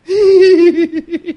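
big burst of laughter, high and loud; a real hearty laugh. Real, not acted. Preacher laughs at scene.

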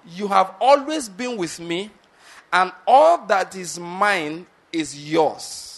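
slow, with weight on "always" and a long stretched "all"; emphatic stress. Acted. Father answers his complaining son, emphatic on "always" and "all". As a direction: stress "always" and "all", drawing the words out slowly. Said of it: "that's my emphasis again".

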